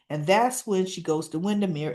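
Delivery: usual speaking voice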